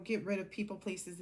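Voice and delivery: ordinary delivery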